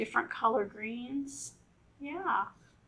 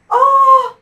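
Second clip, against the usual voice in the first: high, loud yelp; startled cry; 21 dB louder than usual. Acted. Frog startled by the turtle, high loud yelp. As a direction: frog gets a fright. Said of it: "says the frog".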